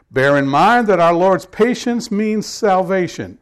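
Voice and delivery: loud, declaiming Bible reading; scripture reading. Acted. Pastor reading Second Peter to his congregation, loud proclamation.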